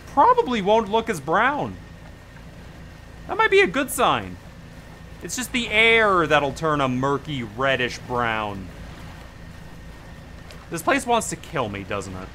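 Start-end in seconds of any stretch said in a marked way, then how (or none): none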